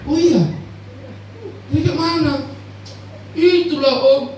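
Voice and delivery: high, loud breaking the news; gleeful church member. Retold or imitated. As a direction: member happily telling the pastor his own pig died. Member reporting the pastor's pig died.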